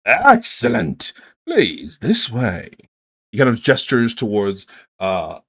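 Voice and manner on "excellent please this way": slow prim official. Acted. Half-elf official.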